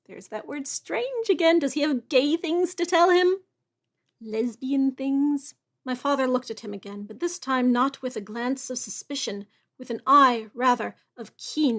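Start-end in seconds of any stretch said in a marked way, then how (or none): 4.2-5.5 slow, drawn-out teasing question; teasing aside. Real, not acted. Reader joking about the book's subtext, slow and sly. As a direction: wink at the hidden subtext. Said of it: "joking aside on "strange".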